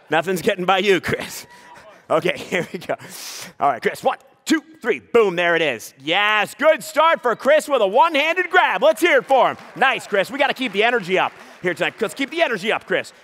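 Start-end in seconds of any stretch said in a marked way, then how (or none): none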